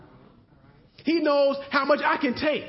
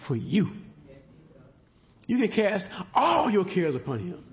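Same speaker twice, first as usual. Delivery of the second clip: ordinary talk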